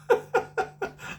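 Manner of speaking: breathy high-pitched laugh; high wheezy laugh. Real, not acted. Laughing at himself for hating Guinness, high wheezing laugh. Laughing at his own story.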